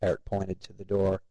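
ordinary delivery, nothing marked

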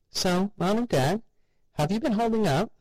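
high, sugary chirp to her parents; sweet mother. A narrator's reading. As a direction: talk to them like happy toddlers. Mother greets zombie parents.

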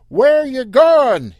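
slow, loud, high call. Acted. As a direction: call it across the sand. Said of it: "he called across the sand".

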